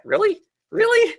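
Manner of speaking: very high, incredulous questioning; incredulous Superman. Retold or imitated. Superman commenting on what Batman said, mock incredulous. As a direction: squeak it out in mock disbelief.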